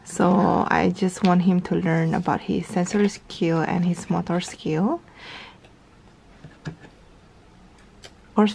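ordinary talk

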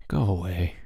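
low, soft, tired dismissal; weary. A narrator's reading. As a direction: dismiss them wearily. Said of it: "said Bruno wearily".

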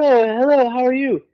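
high, light English gentleman voice; mock a posh rock star greeting. [name] quoted in story.

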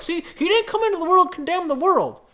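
high, loud, whiny-sounding mock protest; exaggerated high-pitched parody of an argument; mocking objector. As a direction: crow it like a triumphant objector. Mimicking people who object to him.

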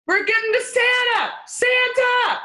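high, mock-frantic yell; retold yelling. Elf clearing a way through the groupies, high mock yell. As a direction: holler it high over a crowd, playfully. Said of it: "I just started yelling".

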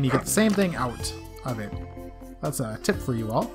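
ordinary talk, nothing marked

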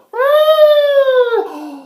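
dramatic shocked gasp; loud high gasp. Acted. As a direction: gasp in horror. Elephant falling.

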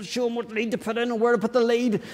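high, softened inner fretting; panicked frustration. Acted. Preacher voicing the thoughts during technical mayhem, panicked and frustrated. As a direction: fret under your breath, pitch pushed up. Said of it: "and all frustrated".

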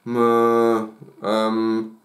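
lost for words, drawn-out low fillers; halting schoolboy stuck for an answer; hesitant stammering. A narrator's reading. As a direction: stall on low ums, unable to get it out. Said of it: "bekommt Micha nicht raus".

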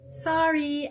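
high, loud, drawn-out "sorry"; chorus it like chastened schoolchildren; sing-out group apology; class replying together. A narrator's reading. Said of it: "everyone replied".

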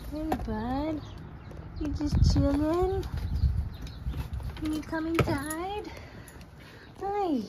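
high slow sing-song to a pet; calling a dog. Real, not acted. Calling dog to come inside.

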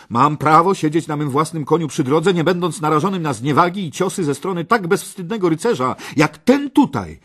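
high, loud protest; indignant cry. A narrator's reading. Parsival protests being struck by a shameless knight, indignant cry. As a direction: protest the insult at full voice. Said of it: "zawołał".

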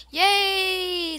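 loud high cheer; real happy cheer. Real, not acted. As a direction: shout yay with glee. Cheering at found item.

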